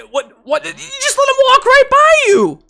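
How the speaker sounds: sputter, then climb to a screech; very high, loud outburst of disbelief; incredulous shriek. Real, not acted. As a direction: yell it at the top of your range. Outraged at the free defender.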